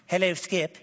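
light mock-English hello; imitated English accent. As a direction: greet a friend in a put-on English accent. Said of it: "a guy from England".

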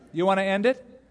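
ordinary talk

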